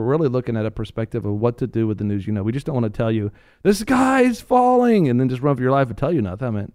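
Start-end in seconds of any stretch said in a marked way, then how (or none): 3.6-5.1 high, slow, hammed-up panic cry; mock alarm. Mocking news that frightens listeners, slow high panic. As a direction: ham up the doom-monger's wail.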